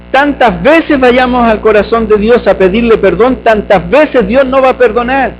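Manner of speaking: normal talking voice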